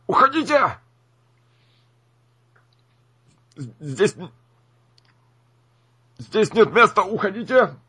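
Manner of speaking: high, tight, strangled voice. Acted. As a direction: squeeze the words out high and tight. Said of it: "сдавленный голос".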